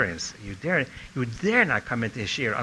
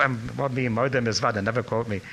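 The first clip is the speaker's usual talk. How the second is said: ordinary delivery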